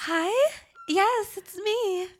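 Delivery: high, slow ditzy doll voice; Barbie voice. Pretend. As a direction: play a breathy plastic doll, high.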